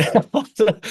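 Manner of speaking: a high laugh bursting out at his own story; high burst of laughter. Real, not acted.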